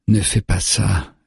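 drawn-out, mildly moaned plea; understated moan. A narrator's reading. Wounded man pleads with his attacker, mild moan. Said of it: "geint-il".

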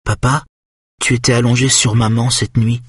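put-on high little boy's voice; clear, high voice of a young son; clear, high-pitched child. A narrator's reading. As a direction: pitch it up like a small child piping up. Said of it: "dit de sa voix claire et haut perché".

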